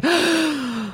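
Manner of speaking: sharp delighted gasp; excited gasp. Retold or imitated. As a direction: gasp with thrill. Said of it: "and I was like".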